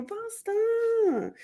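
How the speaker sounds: high call to the dog across the room. Real, not acted. Streamer calling her dog Buster, high-pitched call. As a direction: call the dog's name out high. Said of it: "Sorry, I'm yelling".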